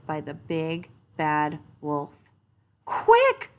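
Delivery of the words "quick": very high, loud squeal; urgent cry. A narrator's reading. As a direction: shout a warning to your brothers. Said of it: "cried the third little pig".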